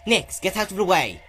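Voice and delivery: loud, high bellow. A narrator's reading. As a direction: force her aside with your voice. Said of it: "Spike bellowed".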